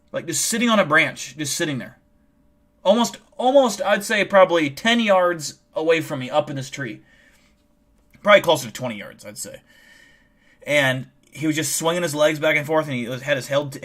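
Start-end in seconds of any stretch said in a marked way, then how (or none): none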